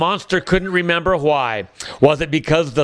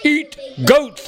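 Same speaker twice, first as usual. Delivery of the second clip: much higher, hollering monster voice; 9 semitones higher than usual. Acted. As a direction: holler it like a stubborn beast. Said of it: "hollered the big blue monster".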